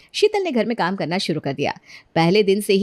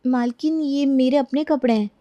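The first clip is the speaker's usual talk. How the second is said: higher-pitched, plaintive servant voice; meek protest; 5 semitones higher than usual. Acted. Maid telling mistress the clothes are hers, meek. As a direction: object timidly.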